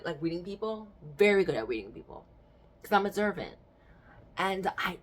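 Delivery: ordinary delivery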